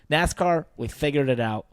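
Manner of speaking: ordinary talk, nothing marked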